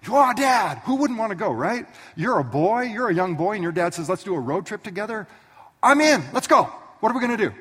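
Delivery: put-on high, loud excited kid voice; eager young boy. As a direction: bounce with a boy's excitement. Young Isaac eager for trip.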